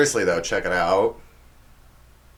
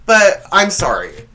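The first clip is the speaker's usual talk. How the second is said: ordinary talk, nothing marked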